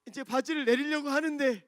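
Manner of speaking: frantic toilet dash; urgent. Retold or imitated. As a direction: hurry it out, about to burst.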